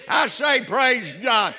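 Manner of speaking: loud, high cry of praise God; shouted praise. Real, not acted. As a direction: lift it up, call out to the church. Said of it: "preacher exclaims praise".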